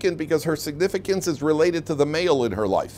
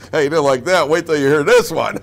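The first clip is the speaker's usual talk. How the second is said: ordinary talk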